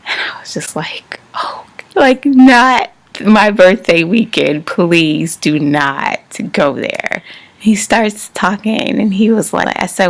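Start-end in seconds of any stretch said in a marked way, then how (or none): 1.3-7.2 slow, emphatic "please, not now"; exasperated plea. Retold or imitated. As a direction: drag out each word in dismay. Inwardly dreading a serious talk.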